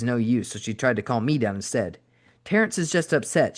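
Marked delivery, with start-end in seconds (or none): 2.4-3.6 West Texas drawl. A narrator's reading. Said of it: "in her West Texas drawl".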